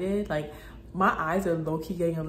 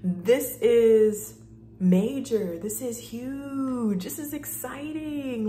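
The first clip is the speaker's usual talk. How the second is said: higher and louder, hyping herself up; hyped self-talk; 7 semitones higher, 6 dB louder than usual. Retold or imitated. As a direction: shout at yourself like a thrilled best friend. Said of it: "I was like".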